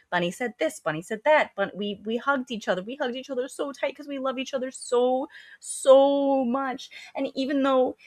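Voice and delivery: high, singsong mock adoration; gushing cult chorus. Mimicking the cult's collective royal we voice.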